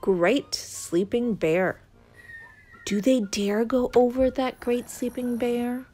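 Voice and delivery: ordinary delivery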